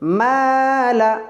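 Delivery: মালা drawn slowly with a light alif; thin মালা. Shown as a demonstration. Teacher models মালা with a thin alif. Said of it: "মিমটাও পাতলা হয়ে যাবে।".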